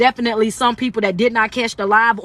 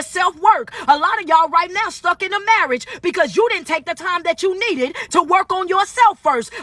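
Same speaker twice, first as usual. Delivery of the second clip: preaching shout; 6 semitones higher than usual. Acted. Coach preaching, building intensity.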